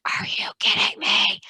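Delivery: unvoiced exasperated whisper; whispered disbelief. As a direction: hiss it so nobody outside hears. Seeing woman at window.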